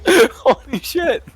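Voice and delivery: slow, high, stunned cry; amazed exclamation. Real, not acted. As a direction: drag it out in awe. Reacting to natural twenty.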